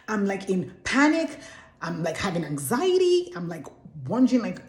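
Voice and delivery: ordinary delivery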